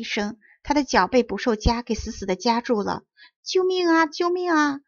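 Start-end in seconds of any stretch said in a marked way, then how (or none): 3.5-4.8 high, loud plea for help; crying for help. Acted. Trapped puppet calls for rescue, high loud cry. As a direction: call out desperately, pitched well up. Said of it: "匹诺曹大声呼叫".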